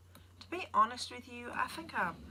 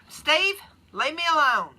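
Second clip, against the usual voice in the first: mock annoyed; 8 semitones higher, 8 dB louder than usual. Teasing a commenting viewer.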